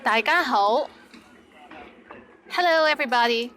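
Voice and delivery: ordinary talk, nothing marked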